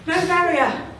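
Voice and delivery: loud and laughing, high up; delighted shout, no barrier; laughing loud insistence. Real, not acted. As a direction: cheer it out loud through laughter.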